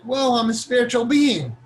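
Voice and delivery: mock wonder.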